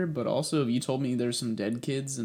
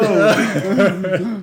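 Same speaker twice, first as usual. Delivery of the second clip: loud burst of laughter; 8 semitones higher, 12 dB louder than usual. Real, not acted.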